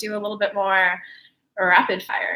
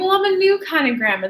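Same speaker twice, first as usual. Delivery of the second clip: high, bright-eyed grandma voice, a bit louder; grandma delighted with herself, pitched up; mock spirit grandma beaming; playful grandma; 8 semitones higher, 4 dB louder than usual. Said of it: "She went".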